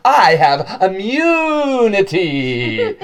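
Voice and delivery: high, loud, drawn-out proclamation; hammed-up indignant boast; pompous retort. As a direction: declare it grandly over them. Self-important guide defies angry colleague.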